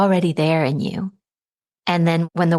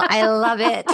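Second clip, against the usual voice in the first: laughing; 4 semitones higher than usual. Real, not acted. Amused after the tingle mix-up.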